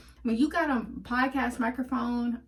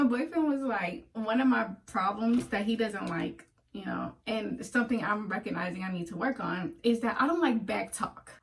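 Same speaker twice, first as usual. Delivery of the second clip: usual speaking voice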